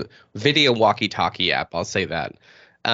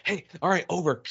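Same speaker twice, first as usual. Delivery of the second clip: hushed walkie-talkie sign-off; 6 semitones higher, 7 dB quieter than usual. Retold or imitated. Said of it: "just checking in".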